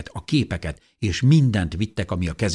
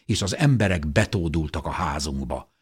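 ordinary delivery

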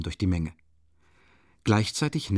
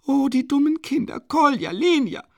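high, loud, frightened shouts; alarmed calling; 7 dB louder than usual. A narrator's reading. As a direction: call after the children in sudden alarm. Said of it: "rief sie plötzlich erschrocken". (erschrocken rufend, hoch und laut)

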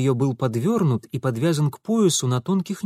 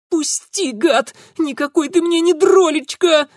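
high, shrieked refusal; furious protest; 6 dB louder than usual. Acted. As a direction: scream at him to let go. Girl fights off Франт. (яростный девичий визг)

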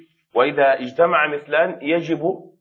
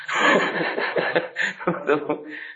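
real breathy chuckle; laughing; 3 semitones higher, 3 dB quieter than usual. Real, not acted. Teacher amused by people praising unclear speech, chuckling.

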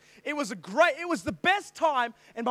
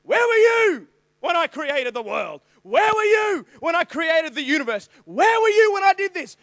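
loud rising divine challenge; booming voice of God; 5 semitones higher, 7 dB louder than usual. Retold or imitated. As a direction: thunder the questions at him. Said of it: "Job chapter thirty-eight".

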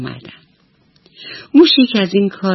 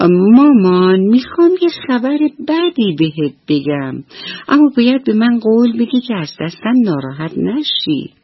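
put-on higher, small pleading child voice; thin little voice; 6 semitones higher than usual. Little mouse confesses to his mother in a thin, higher voice. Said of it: "با صدای نازکش گفت".

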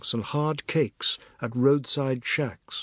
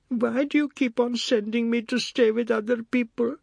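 put-on hurt boy; 10 semitones higher than usual. Boy complaining to mother.

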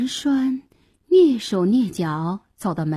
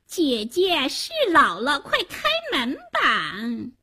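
pleading little sisters; 6 semitones higher, slower than usual. A narrator's reading. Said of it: "喊道".

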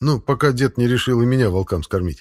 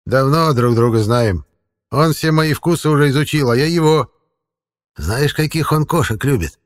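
lightly voiced old forest man; a hint of a folksy old man; creepy caretaker; 6 semitones higher, 4 dB louder than usual.

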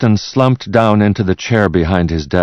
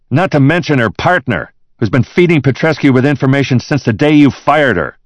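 high, louder associate's voice; accusing associate; 10 semitones higher, 5 dB louder than usual. Acted. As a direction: point the blame in a raised voice. Colleague's line voiced in narration.

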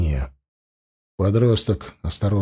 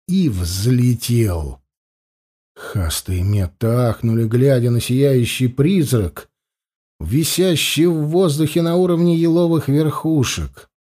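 ordinary delivery, nothing marked

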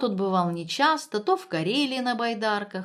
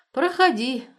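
resigned sigh; 6 semitones higher, 6 dB louder than usual. A narrator's reading. Friend lets in the man with a suitcase, sighing. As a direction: let him in with a mild sigh. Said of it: "Борька вздохнул и сказал".

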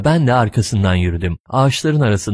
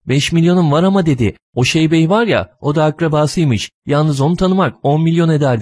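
pitiful woman's voice; 6 semitones higher than usual. A narrator's reading. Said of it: "zavallı bir kadın sesiyle konuştu".

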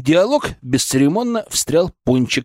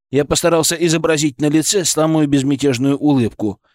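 usual speaking voice

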